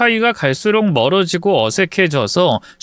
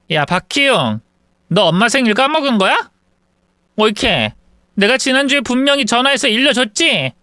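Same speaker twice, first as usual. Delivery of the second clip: higher, quick mild mock reproach; confronting older sister; 7 semitones higher than usual. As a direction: call the brother out sharply. (남동생을 다그치는 누나, 높고 빠르게)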